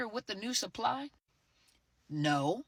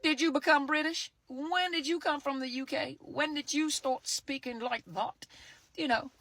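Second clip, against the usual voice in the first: mock incredulous questioning; 6 semitones higher than usual. Quoting herself quizzing the ex about his new accent, mock disbelief. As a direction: act stunned that your ex suddenly sounds British. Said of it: "You're like".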